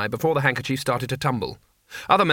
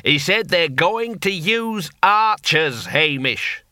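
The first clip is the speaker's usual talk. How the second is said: slow, raised voice; repeating for the deaf; 4 semitones higher, much slower than usual. Acted. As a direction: spell it out slowly for a deaf friend.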